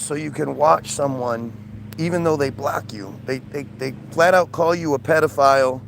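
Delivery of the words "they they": usual speaking voice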